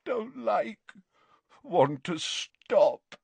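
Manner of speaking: high, drawn-out moan; frightened, pleading moan. A narrator's reading. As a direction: moan it out in distress. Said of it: "moaned Dumbledore".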